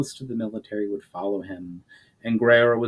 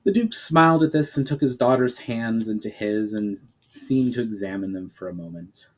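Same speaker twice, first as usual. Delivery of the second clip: ordinary delivery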